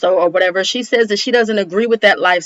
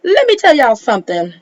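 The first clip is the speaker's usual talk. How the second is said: quick, higher-pitched attention grab; quick emphatic warning; 5 semitones higher, 4 dB louder, faster than usual. Real, not acted. Host about to set viewers straight, quick and emphatic. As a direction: fire it off fast and high.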